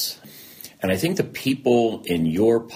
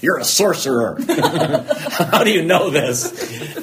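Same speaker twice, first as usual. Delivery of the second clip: louder and higher, laughing through it; laughing disbelief; 11 semitones higher, 6 dB louder, much slower than usual. Retold or imitated. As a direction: laugh in amazement at how he knew. Laughing retelling of therapist's insight.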